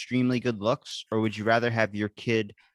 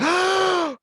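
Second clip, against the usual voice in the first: big, high, hammed-up gasp; mock gasp of delight; excited gasp; 6 dB louder than usual. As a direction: gasp like a thrilled kid. Acts out childhood thrill at grocery trip.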